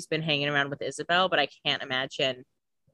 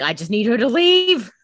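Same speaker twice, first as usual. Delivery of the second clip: higher, louder mock wail; mock desperate outburst; 6 semitones higher, 8 dB louder than usual. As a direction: build to a hammed-up, desperate plea. Voicing Damon near tears, mock.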